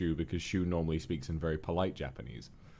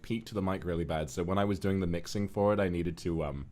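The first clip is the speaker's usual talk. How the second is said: normal talking voice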